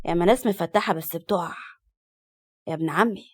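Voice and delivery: low, cool retort; cold. A narrator's reading. Man answering his scheming cousin, cold. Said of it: "وقال له ببرود".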